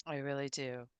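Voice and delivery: soft, low, sincere thanks; heartfelt thanks. Real, not acted. Host quietly thanking the guest for his vulnerability, tender. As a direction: quietly tell him you understand.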